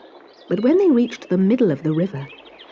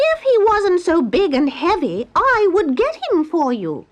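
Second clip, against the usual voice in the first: high, louder bird voice; stork voice; 8 semitones higher, 4 dB louder than usual. Acted. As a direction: stork doubting she can lift him. Stork answers.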